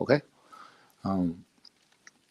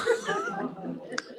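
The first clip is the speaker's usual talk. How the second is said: quiet laugh; 11 dB quieter than usual. Real, not acted. Laughs quietly about vegetables.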